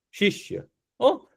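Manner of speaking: શિષ્ય said as a soft breathy whisper; hushed whisper. Shown as a demonstration. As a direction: whisper શિષ્ય as if sharing a secret. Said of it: "બોલો તો, શિષ્ય".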